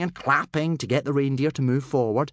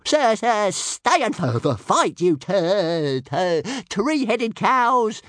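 high, loud stuttering dragon taunt; cheeky little dragon yelling insults; insulting shout; 11 semitones higher, 5 dB louder, slower than usual. Acted. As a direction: jeer after the fleeing reindeer, tripping on the words. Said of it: "he shouted insultingly".

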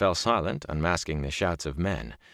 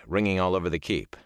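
plain reading voice

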